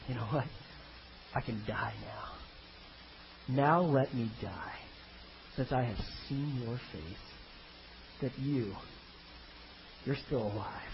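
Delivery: soft, halting words of a father at peace; quiet contentment. Acted. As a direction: let it go softly, with long pauses. Old father content to die now.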